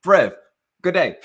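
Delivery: loud, high put-on British greeting; mock English accent. Speaker faking English to a stranger, in a British accent. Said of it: "he actually thought that I was English".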